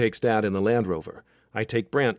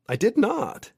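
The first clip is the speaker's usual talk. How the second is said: indignant denial; 12 semitones higher, 4 dB louder, slower than usual. Acted. Childish argument with best friend.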